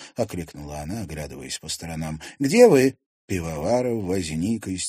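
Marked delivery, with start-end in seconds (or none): 2.4-3.0 loud worried call. Acted. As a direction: call into the darkness. Calling out in the dark.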